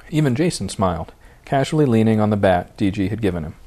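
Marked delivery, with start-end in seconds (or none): none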